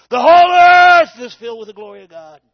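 huge, high, full-voiced shout of glory; proclaiming the seraph's cry at full force; shouted proclamation. Angel's cry in a sermon reading.

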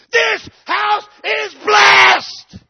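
full-throated cry, very high and very loud, drawn out; shouted declaration. Retold or imitated. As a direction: roar it at the street like a war cry.

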